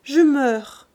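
high, plaintive moan; anguished invalid's cry; dying mother. A narrator's reading. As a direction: moan it out in distress. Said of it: "dit la mère d'une voix faible".

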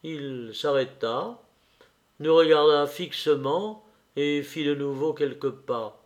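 ordinary delivery, nothing marked

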